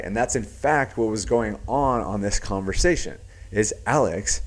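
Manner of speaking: ordinary talk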